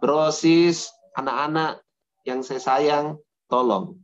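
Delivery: ordinary delivery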